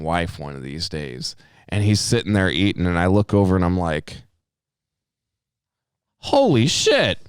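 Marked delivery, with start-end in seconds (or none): 6.2-7.2 high, slow amazed exclamation; stunned recognition. Retold or imitated. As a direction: draw it out in acted astonishment. Acted shock on spotting the man.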